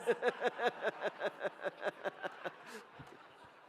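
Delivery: long, high-pitched laugh; real laugh. Real, not acted. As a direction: laugh it off at length. Laughing at being cropped from photo.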